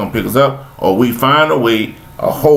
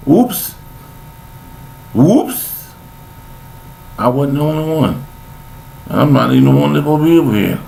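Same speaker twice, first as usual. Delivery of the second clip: high startled oops, mock realization; mock surprise; 4 semitones higher than usual. Dead man wakes and realizes he's not alone, mock surprise. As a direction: play the dead man's sudden discovery. Surprised soul waking after death.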